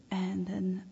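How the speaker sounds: usual speaking voice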